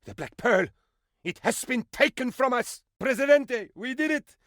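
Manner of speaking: high, excited exclamations; excited outburst. Acted. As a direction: burst out with the news. Alarmed then triumphant announcements.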